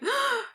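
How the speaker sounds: sharp, high gasp; mock startled intake of breath. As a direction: gasp like someone jolting awake. Character comes to, retold.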